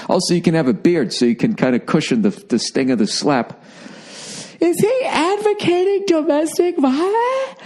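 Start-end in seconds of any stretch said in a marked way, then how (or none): 4.6-7.6 very high, slow mock voice of a scandalised critic; outraged blogger. Critic questions the comic's joke, in a very high mocking voice. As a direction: ham up a shocked pearl-clutcher.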